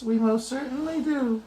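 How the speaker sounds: a tiny voice from a dust speck; squeaky little mayor; tiny Who voice. Acted. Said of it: "piped the voice".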